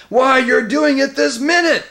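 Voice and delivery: high, full-voiced bellow; very loud, high indignant shout. A narrator's reading. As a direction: bellow the accusation in outrage. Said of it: "bellowed Trumpkin".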